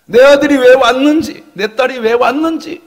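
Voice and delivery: high, loud swelling assurance; impassioned mother. Acted. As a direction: cry out that you understand your child. (북받쳐 외치는 엄마)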